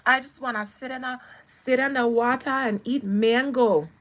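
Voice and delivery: loud Barbados patois with Irish in it; Irish-tinged Barbadian lilt, pushed out loud; Barbadian accent. Commentator voicing a homesick celebrity, Barbadian accent. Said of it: "Barbados accent".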